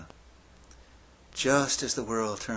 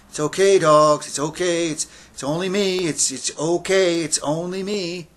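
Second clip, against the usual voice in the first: high, loud, repeated pleading to calm dogs; anxious reassurance; 8 semitones higher, 10 dB louder than usual. Acted. Man alone on a dark road talking to barking dogs, anxiously reassuring. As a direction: call out nervous reassurance, pitched high. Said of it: "I'm talking to the dogs".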